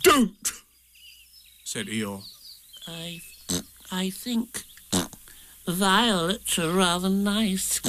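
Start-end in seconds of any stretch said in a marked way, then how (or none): 0.0-0.5 high, abrupt refusal; curt cut-off. A narrator's reading. Eeyore stopping Piglet from thinking, curt. 2.9-7.8 soft, halting little piglet voice; nervous. A narrator's reading. Piglet offering violets to Eeyore, nervous. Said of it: "began Piglet nervously".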